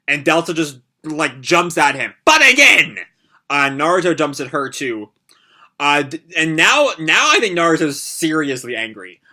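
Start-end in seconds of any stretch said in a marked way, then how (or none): 2.3-3.0 high, loud attacking cry; battle shout. Acted. Fighter jumping at an opponent, shouting. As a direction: shout it out as you lunge.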